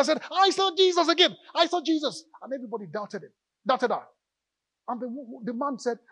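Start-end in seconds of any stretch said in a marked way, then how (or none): 0.3-2.2 put-on high, loud, thrilled child's voice; excited little girl. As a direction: burst in with the big news. Girl returns excited in story.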